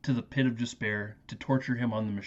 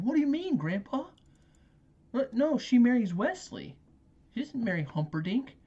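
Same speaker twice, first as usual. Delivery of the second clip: much higher, protesting grandson; whiny kid voice; 11 semitones higher than usual. Retold or imitated. The grandson protests to Grandpa, high whiny kid voice. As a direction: play the outraged little kid. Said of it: "and then it interrupts us again".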